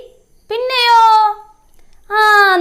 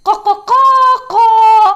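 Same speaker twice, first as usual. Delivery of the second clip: loud, high cock-a-doodle-doo; high rooster call, swelling louder; realistic rooster crow; 5 semitones higher, 5 dB louder, slower than usual. Shown as a demonstration. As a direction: crow out like a real rooster, short notes then long. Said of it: "ശരിക്കും എങ്ങനെയാ?".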